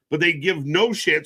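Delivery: ordinary delivery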